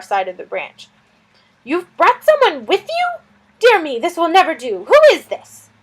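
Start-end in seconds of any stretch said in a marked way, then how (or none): 1.6-5.7 high, loud, fretful lizard voice; a flustered little lizard fretting over a guest; fussy lizard. Acted. Lizard Suz fussing about the stranger, high and loud.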